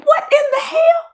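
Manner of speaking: high-pitched exclamation of disbelief; retold, exasperated high cry; incredulous outburst. Retells her baffled reaction.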